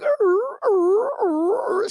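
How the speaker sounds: high whimpering dog cry; imitated dog whine. Mimicking Cookie at the groomer with a high whine. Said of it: "she, like, starts crying".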